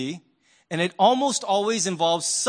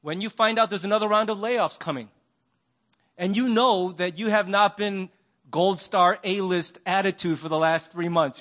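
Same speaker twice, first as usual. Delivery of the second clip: normal talking voice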